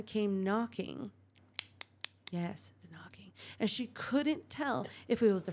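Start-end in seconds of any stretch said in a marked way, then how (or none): 2.3-3.3 soft unvoiced eerie whisper; spooky whisper. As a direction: hush it like a ghost story. Spooky hushed aside.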